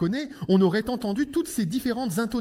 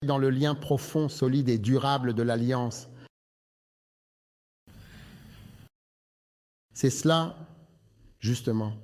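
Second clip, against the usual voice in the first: lower, measured sermon voice; preaching; 10 semitones lower than usual. Acted. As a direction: preach with weight.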